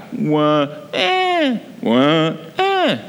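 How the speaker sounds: imitating a baby's wah-eh, jumping high on eh; mock French baby cry, low then sharply high; baby cry rising low to high. Shown as a demonstration. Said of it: "So they would cry".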